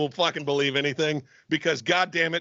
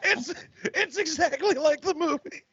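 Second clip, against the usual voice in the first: laughing; 13 semitones higher, slower than usual. Real, not acted. Cracking up at the quote.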